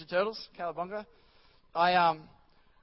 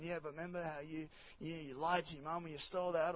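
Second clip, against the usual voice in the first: much softer, insinuating devil; accusing devil voice; 3 semitones lower, 12 dB quieter than usual. Retold or imitated. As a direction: sneak in the accusation.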